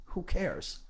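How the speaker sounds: ordinary delivery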